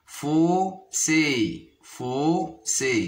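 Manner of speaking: "fossé" split as fo, pause, ssé; syllable by syllable. Shown as a demonstration. Teacher counting syllables for pupils, "fossé" split in two. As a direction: draw out and separate both syllables of "fossé". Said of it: "se compose de deux syllabes".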